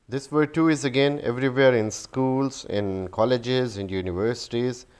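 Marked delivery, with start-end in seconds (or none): none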